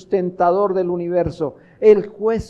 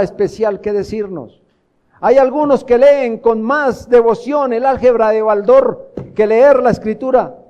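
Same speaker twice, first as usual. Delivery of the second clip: high, raised pulpit exhortation; forceful preaching; 5 semitones higher, 5 dB louder than usual. Real, not acted. Preacher pointing brothers to Scripture, forceful preaching. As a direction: preach it hard, punching the key words.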